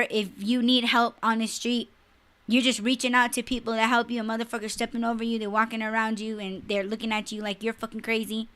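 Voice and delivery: normal talking voice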